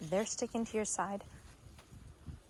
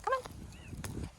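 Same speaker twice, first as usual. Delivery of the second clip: excited dog voice; 5 dB quieter than usual. Real, not acted. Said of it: "she's really excited about my voice".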